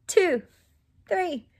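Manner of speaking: usual speaking voice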